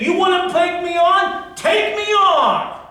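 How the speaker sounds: high, loud, slow taunting dare; in-your-face challenge. Acted. God imagined getting in Elijah's face, loud and slow taunting. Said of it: "get in his face and say".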